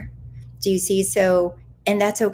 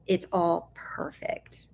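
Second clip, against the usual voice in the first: a soft whisper to close; 8 dB quieter, slower than usual. Acted. To her viewers, whispering that it's all perfect. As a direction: breathe the words out.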